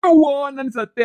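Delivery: high, loud, slow wail of pain; loud, drawn-out cry of pain. Acted. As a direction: drag it out like you're being beaten. Husband wailing under the blows.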